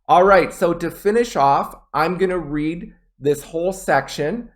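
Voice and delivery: ordinary delivery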